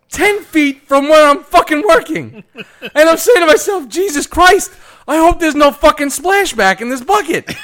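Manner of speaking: high, loud comic exasperation; worked-up yelling with laughs breaking in; appalled rant. Retold or imitated. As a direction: shout it in horrified disbelief. Appalled meter reader recounting the scene.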